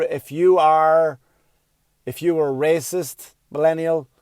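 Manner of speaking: ordinary delivery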